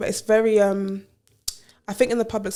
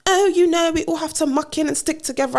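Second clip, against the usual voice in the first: mocking breezy coworker; 7 semitones higher than usual.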